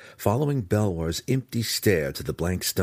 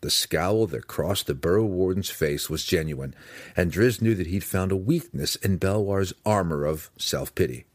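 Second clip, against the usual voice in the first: ordinary delivery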